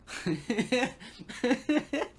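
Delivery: high mocking cackle; hammed-up evil cackle; villain laugh. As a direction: gloat with a big laugh. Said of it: "If you don't know why I'm laughing".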